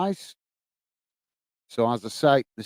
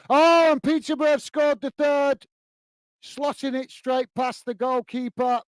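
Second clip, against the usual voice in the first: higher, excited goal shout; goal call; 8 semitones higher than usual. Real, not acted. Streamer calling a goal to his chat, higher with excitement. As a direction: lift right up as the goal goes in. Goal scored during live commentary.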